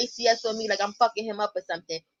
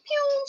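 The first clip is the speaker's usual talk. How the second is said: zooming away sound. Retold or imitated. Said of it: "She was like".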